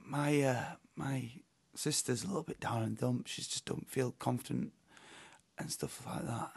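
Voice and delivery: near-whisper, confiding a worry; soft hushed mum voice. Retold or imitated. Mum quoted about her sister.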